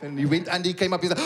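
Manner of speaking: usual speaking voice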